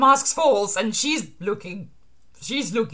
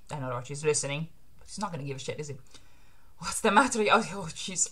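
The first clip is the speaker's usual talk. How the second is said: ordinary talk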